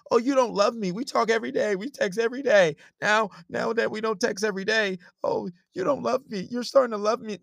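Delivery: hammed-up girlfriend feeling neglected; mock whiny girlfriend. Mimicking girlfriend's whine.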